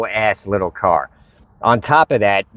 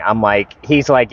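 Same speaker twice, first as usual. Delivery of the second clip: ordinary delivery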